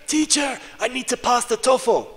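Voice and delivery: high, loud, desperate student begging; pleading student. Retold or imitated. Student begging the teacher to help her pass, loud and high. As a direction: beg the teacher at full volume like an anxious student. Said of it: "I used to have students".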